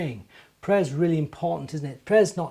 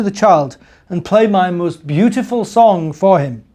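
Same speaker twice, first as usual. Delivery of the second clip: louder, higher earnest giant; 4 semitones higher, 12 dB louder than usual. Acted. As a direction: tell it like a hopeful giant. Giant speaks.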